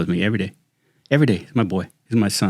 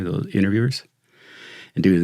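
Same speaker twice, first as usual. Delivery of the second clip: usual speaking voice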